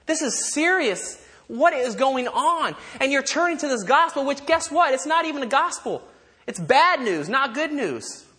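high-pitched, urgent rebuke; indignant alarm. Acted. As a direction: press the warning home, appalled. Voices Paul's alarm at the Galatians.

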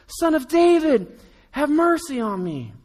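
loud high desperate shout; crying out. Retold or imitated. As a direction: cry out to Jesus for mercy. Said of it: "he cries out".

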